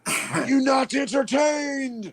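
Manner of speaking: slow, high, defiant gladiator shout; booming defiant gladiator. Shown as a demonstration. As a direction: bellow it like an arena gladiator. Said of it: "in your gladiator voice".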